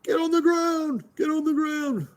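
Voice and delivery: imitating a cop shouting at a suspect; cop yelling orders. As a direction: bellow it like a cop at gunpoint. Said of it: "he goes".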